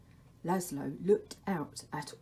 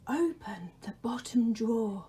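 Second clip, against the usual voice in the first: the Dark's whisper; 4 semitones higher than usual. Acted. The Dark calls to Laszlo in a whisper. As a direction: breathe his name from the shadows.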